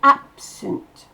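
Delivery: "Absent" with a bursting ab and swallowed sunt; punchy ab. Shown as a demonstration. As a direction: explode the ab of "Absent". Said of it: "c'est ab qui explose". ("Absent" avec ab qui explose)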